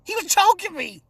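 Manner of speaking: a hint of an indignant mother; mother's voice. The mom brushing off the tapping talk, mild mock indignation. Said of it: "She said".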